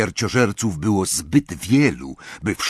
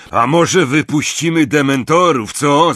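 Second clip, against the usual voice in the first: loud, high calling proposal; eager shout; 7 semitones higher, 8 dB louder than usual. Acted. Another Death Eater proposes releasing dementors, shouting. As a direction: shout the cruel idea to the others. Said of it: "zawołał inny śmierciożerca".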